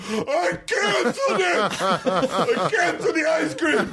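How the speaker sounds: high, slow, laughing yell; hotel guest yelling at staff through the door; screaming from bed. Retold or imitated. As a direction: yell across the room from bed. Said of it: "I'm screaming from the bed".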